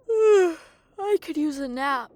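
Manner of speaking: slow, sighing and worn out; exhausted, sighing before a nap; tired sigh. Acted. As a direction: let the day's walking drag the words out. Worn out after walking.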